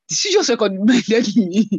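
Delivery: retold amazement breaking into a laugh; overwhelmed disbelief. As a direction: laugh in disbelief as you ask. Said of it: "You know, I was overwhelmed".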